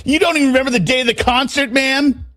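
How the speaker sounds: incredulous, high and fast; mocking disbelief at the witness; sarcastic. Real, not acted.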